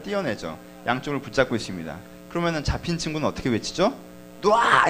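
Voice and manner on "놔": loud high mock yell of a held-back boy; shout of let go. Boy held back in a fight, yelling at his friends, mock shout. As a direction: bark it out like a kid being pulled apart. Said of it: "이렇게 외치죠".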